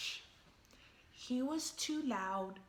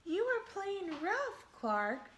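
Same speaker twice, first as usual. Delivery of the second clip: put-on kids complaining; 7 semitones higher than usual. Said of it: "cried the other kids".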